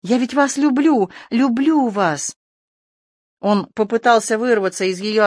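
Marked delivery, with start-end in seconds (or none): 0.0-2.3 high, desperate declaration; impassioned, wavering plea; voice trembling. A narrator's reading. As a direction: cry out love in anguish. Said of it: "голос её дрогнул".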